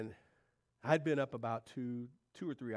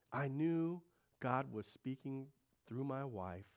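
slow deliberate conviction; slow solemn; slower than usual. Real, not acted. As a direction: lay each word down slowly. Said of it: "slow solemn emphasis".

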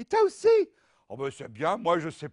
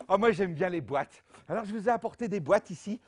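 normal talking voice

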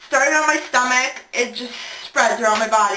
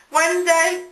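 ordinary delivery

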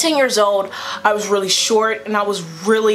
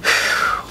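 long, voiceless blown-out breath; steadying exhale. Retold or imitated. Teller mentally preparing to face the girl, steadying exhale. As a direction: blow out air to steady yourself.

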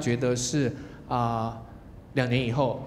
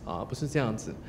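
normal talking voice

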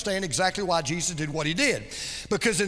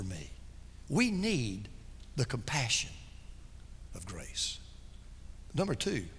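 hushed preaching; 5 semitones lower, 9 dB quieter than usual. Acted. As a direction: draw the congregation in, much softer. Hushed intimate preaching passage.

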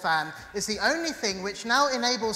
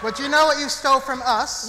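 dramatic accusation; 3 semitones higher, 8 dB louder than usual. Acted.

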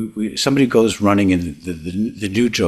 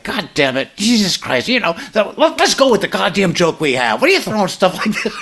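much higher, half-laughing mock shout; Alan Brady rant; 11 semitones higher than usual. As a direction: go full egomaniac TV host blowing up at his writers. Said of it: "Carl Reiner leaves and Alan Brady appears".

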